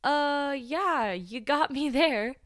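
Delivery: high, loud, drawn-out stunned concession; dumbfounded reply. Retold or imitated. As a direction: imitate a speechless coworker. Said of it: "left her mouth just wide open".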